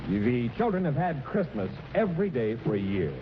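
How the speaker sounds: ordinary talk, nothing marked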